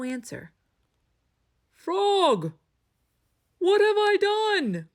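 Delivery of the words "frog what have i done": high, loud remorseful wail; anguished cry. A narrator's reading. Toad regrets driving Frog away, anguished high cry. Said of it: "cried Toad".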